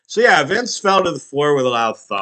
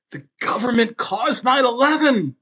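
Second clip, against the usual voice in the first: conspiracy nut; 10 semitones higher than usual. Pretend. Sudden conspiracy outburst.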